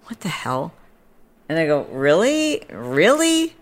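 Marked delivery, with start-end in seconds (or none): 0.1-0.7 hushed, incredulous aside; baffled whisper. Retold or imitated. Baffled teller reacting to the stranger, incredulous whisper. 1.9-3.6 high rising query, climbing higher on repeat; mock incredulity. Teller doubting the stranger's baby-name claim, high incredulous challenge. Sceptical reply to gushing stranger.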